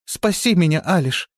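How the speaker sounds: high, loud cry for help; frightened plea. A narrator's reading. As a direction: call out in fright. Frightened girl calls for help.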